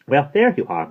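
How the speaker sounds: high, loud chiding lady; brisk greeting. A narrator's reading. Miss Christabel greets late Maggie, brisk chiding voice. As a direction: greet the latecomer pointedly.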